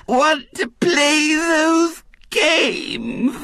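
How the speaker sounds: high, slow, drawn-out wail; plaintive, defeated. A narrator's reading. Defeated voice from the other end of the table, plaintive. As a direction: whine it miserably. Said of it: "the plaintive, defeated voice".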